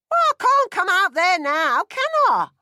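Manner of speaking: very loud, high screech; mother screaming back at her son from the back; screamed back. Acted. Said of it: "his mother screamed back".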